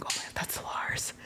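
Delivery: breathless starstruck whisper, barely voiced; awed whisper. Fan sees his idol across the room, awed whisper. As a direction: hush it like you can't believe your eyes.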